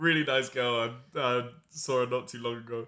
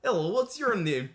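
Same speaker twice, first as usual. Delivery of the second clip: put-on higher-pitched Ukrainian accent; 7 semitones higher than usual. Comic imitating a Ukrainian office admirer, Ukrainian accent. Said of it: "I can't do the accent".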